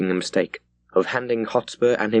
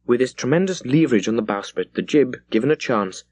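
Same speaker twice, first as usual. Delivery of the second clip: plain reading voice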